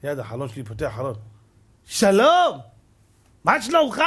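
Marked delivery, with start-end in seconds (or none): 1.8-4.0 high, loud sarcastic hello; loud mock-friendly driver's hail; mocking greeting from car. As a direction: call across from the next car window, much higher. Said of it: "בא איזה אחד".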